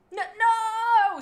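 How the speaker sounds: high, loud furious shriek; angry screech. Acted. Moonflower screeches angrily as her paw passes through the ghost. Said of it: "screeched Moonflower angrily".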